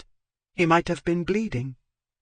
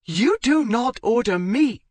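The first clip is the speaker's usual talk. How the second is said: high and loud, indignant refusal; defiant; 10 semitones higher, 6 dB louder than usual. Acted. Zoe defying Marchmont's order, high and loud. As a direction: throw the order back at him.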